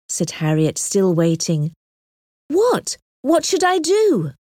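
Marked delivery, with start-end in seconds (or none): 2.5-4.3 much higher, urgent questioning; anxious pleading. A narrator's reading. Harriet waits on Emma's advice about the proposal, asking high and anxious. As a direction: press for an answer, pitch jumping up.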